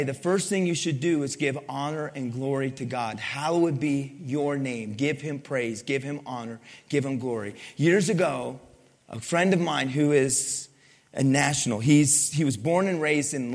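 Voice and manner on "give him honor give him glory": ordinary delivery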